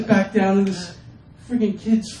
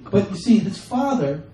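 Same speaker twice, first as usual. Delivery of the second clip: normal talking voice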